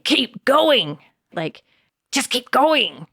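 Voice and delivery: curt, demanding tone; a mean inner voice pushing you; harsh push. Shown as a demonstration. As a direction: grind it out like a drill sergeant. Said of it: "Same words, totally different effect".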